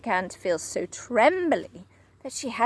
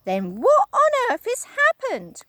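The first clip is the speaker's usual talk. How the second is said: much higher, louder anxious cry; Missus Twit crying out; 12 semitones higher, 6 dB louder than usual. Acted. Wife begging to know, high cry. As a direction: wail it like a flustered old woman. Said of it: "cried Missus Twit".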